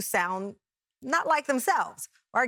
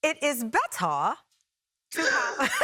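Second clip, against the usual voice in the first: received pronunciation from memory, crisp T in "better"; British RP accent. Shown as a demonstration. Student greeting the coach, recalled British RP accent. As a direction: try out the Queen's English just learned. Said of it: "Excellent, you retained it".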